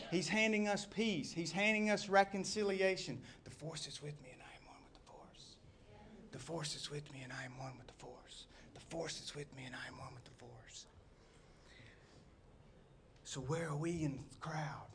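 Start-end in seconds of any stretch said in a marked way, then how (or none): 3.4-10.8 low, hushed whisper; whispered mantra. Acted. Preacher reciting a mantra about the force, whispered. Quietly chanted film mantra in sermon. 13.2-14.9 very soft, half-whispered question; hushed question. Real, not acted. Preacher asking his people where they stand, hushed.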